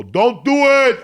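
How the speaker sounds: loud, high, urgent yell of don't do it; warning shout. Retold or imitated. Cook yells a warning at the inmates. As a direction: shout it to stop them cold. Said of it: "I'd go".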